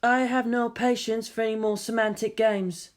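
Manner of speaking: Raka, fed up with semantic games, sighing; weary sigh. Acted. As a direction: sigh the words out, tired of games. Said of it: "Raka sighed again".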